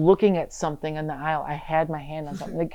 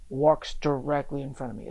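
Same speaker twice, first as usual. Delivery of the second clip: ordinary talk, nothing marked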